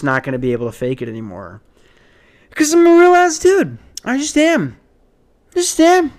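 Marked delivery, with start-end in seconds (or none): 2.5-6.1 put-on high, loud drunk-sounding insistence; much higher voice, as if tipsy. Podcaster insisting on himself, high and drunk-sounding. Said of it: "Do I sound drunk right now".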